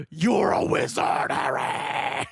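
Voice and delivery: slow, distorted mock metal growl; metal scream. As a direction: scream it like a metal band's singer. Acts Hagrid as metal singer.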